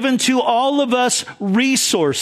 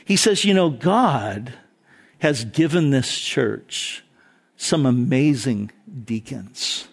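put-on wise older man; 7 semitones lower than usual. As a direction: lightly voice the calm mentor setting him straight. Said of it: "And he goes".

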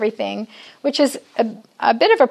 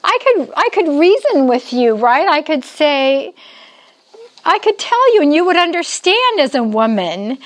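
higher, insistent, pleading to be understood; earnest raised reasoning voice; emphatic tone; 5 semitones higher than usual. Real, not acted. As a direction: press the point hard. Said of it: "I wouldn't have to talk in this tone".

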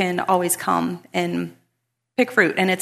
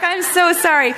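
high, loud gushing apology; over-apologize, pitched right up; flustered retold apology; 10 semitones higher, 6 dB louder than usual. Speaker apologizes to neighbor for not sharing, acted high fluster.

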